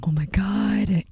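whispered in shock; stunned whisper over the open cage; horrified whisper. Retold or imitated. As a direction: breathe it out in disbelief. Finding the empty cage.

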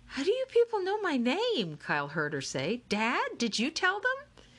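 high, put-on girl's voice, puzzled; Akemi's startled voice. Akemi to the crowd, high puzzled voice. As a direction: ask it high and bewildered.

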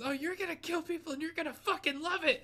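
high, loud mock bellow; drill sergeant. Drill sergeant overruling the reluctant recruit, yelled. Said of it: "the drill sergeant's like".

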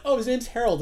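informing. Retold or imitated. Someone tells a scared kid the yeti's name, informing. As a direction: break the news in a lifted voice. Retold exchange, other person announces name.

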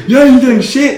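high, loud mock challenge; hammed-up hot-headed yell; acted confrontational shout. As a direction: imitate a friend squaring up to police. Friend confronts the cops.

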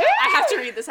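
high, loud burst; giddy, urgent squeal; excited outburst. Real, not acted. Excited outburst before reading aloud.

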